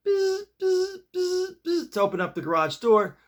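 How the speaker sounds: high buzzing remote sound; imitated buzz. Telling a story about the garage remote, imitated buzz. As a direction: mimic the garage opener's buzz.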